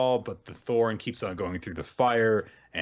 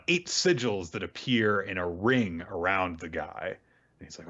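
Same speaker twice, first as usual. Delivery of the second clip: ordinary delivery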